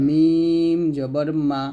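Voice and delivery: "মিম জবর মা" drawn out very slowly, lips closing on "মা"; slow demo. Shown as a demonstration. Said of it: "দুই ঠোঁটের শুকনো অংশ থেকে উচ্চারণ করি". (খুব ধীরে "মিম জবর মা")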